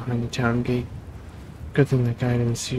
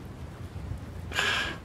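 weary sigh; 9 dB quieter than usual. Real, not acted. Tired sigh at hopeless situation.

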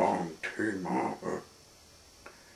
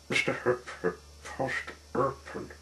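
plain reading voice

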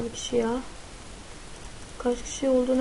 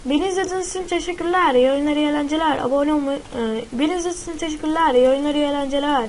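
higher, upbeat sign-off; youtuber outro; 3 semitones higher than usual. Acted. Gamer signing off to viewers. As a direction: thank viewers brightly and wrap up.

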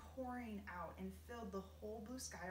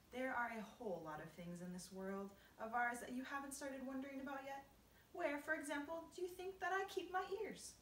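a hint of a teasing teacher; lightly voiced old grasshopper; 6 semitones higher, 3 dB louder than usual. Old grasshopper quizzing the boy, mildly teasing rise. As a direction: pose a riddle, rising in pitch.

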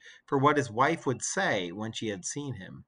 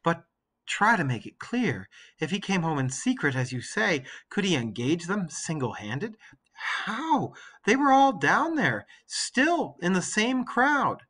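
Penelope, weary; 6 semitones higher, 4 dB louder than usual. Acted. Penelope doubts.

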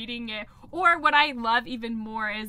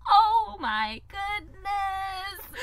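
very slow, high, drawn-out oh my goodness; restrained exasperation; 9 semitones higher, 4 dB louder, much slower than usual. Retold or imitated. Nanny biting back anger at a kid, slow. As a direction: hold back anger by stretching every word. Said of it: "I'm restraining myself".

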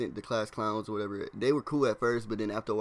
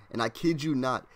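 usual speaking voice

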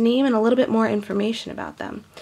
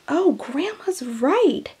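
ordinary talk, nothing marked

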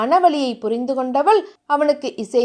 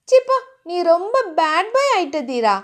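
put-on higher, coy girlish reproach; playful scolding; 4 semitones higher than usual. Heroine pushes her lover away, playfully scolding. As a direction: scold coyly with a hint of a laugh.

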